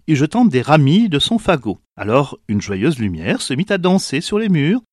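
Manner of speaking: ordinary delivery, nothing marked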